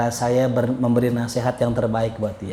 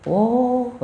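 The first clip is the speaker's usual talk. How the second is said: surprised oh; 10 semitones higher than usual. Retold or imitated. Teacher reacts to gossip.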